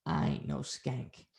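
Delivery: low, slow, hushed last word; retold menacing retort. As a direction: imitate her cold, drawn-out retort. Retold last retort before killing him.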